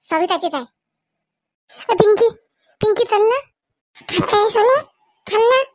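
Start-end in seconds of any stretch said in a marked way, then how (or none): 1.9-5.6 put-on loud call to a child outside, voice raised high. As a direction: shout across the yard for Pinky. Mother calls daughter Pinky outside. (पिंकीला मोठ्याने हाक मारणे)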